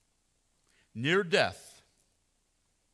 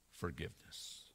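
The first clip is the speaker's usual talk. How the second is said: breathed out, barely a voice, much lower and softer; whispered; 10 semitones lower, 13 dB quieter than usual. Acted. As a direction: let the word fall away in a whisper. Climax of sermon line.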